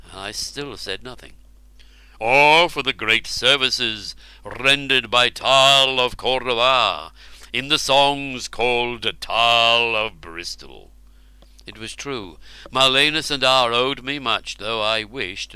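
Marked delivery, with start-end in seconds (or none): 2.2-10.9 slow, grand royal voice; royal king voice. Acted. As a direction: speak slowly like a king. Ubar speaks.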